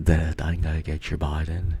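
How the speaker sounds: put-on unsure Australian accent; attempted Australian accent. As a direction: try the line in an Australian accent. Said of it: "I'm trying to do the Australian".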